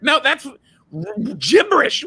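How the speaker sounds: normal talking voice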